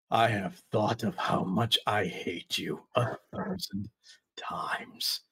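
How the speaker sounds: a low rumbling whisper with a hiss; creeping, whispered menace. Acted. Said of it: "a low, rumbly, whispery, kind of hissing voice".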